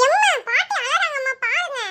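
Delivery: calling out to mother. Acted. Child calls mother, grandma crying.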